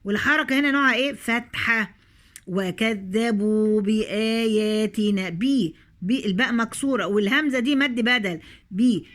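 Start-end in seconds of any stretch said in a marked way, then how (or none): 2.5-5.3 وكذبوا بآياتنا slowly, the ذ held two beats; slow tajweed. Shown as a demonstration. Teacher shows the madd on the dhal, slow and careful. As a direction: give the ذال its two counts. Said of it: "الذال هنا بعد عليها حركتين".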